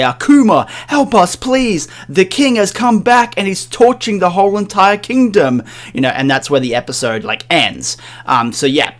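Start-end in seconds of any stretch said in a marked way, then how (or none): 0.1-5.6 high, loud, urgent cry for help; elderly townsfolk begging Kuma as the town burns; desperate plea. Retold or imitated. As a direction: beg him to save them. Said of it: "and they say".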